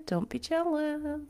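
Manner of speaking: playful teasing brag; mock boast. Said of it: "I have an amazing rat tail".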